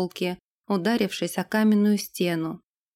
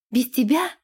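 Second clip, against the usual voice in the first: higher, ardent question; 4 semitones higher than usual. A narrator's reading. Irga turns to the man she loves, ardent. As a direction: ask it with fervour. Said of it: "пылко спросила она".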